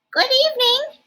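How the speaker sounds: very high, smooth monster voice; high silky voice. Acted. Monster Ralph greets the child in a very high silky voice. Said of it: "he said in a high, silky voice".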